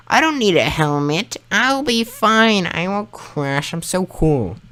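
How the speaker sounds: high, dismissive bragging voice; cocky teen. Retold or imitated. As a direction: brush it off like a know-it-all kid. Arguing with mother, acting cocky.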